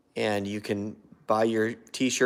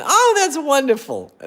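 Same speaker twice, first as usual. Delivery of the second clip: much higher and louder, gushing; excited high voice; 8 dB louder than usual. Shown as a demonstration. Showing how his excitement slips into a high voice, gushing. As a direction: squeal it out in delight. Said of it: "So if I get excited about something".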